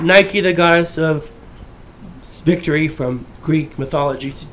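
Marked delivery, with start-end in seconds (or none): none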